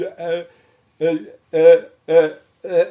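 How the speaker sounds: loud, strangled "uh" sounds; stuck grunts, trying to force words out; tongue-tied grunting. Shown as a demonstration. Patient losing speech on the phone, tongue-tied grunting. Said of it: "something started to happen to my voice".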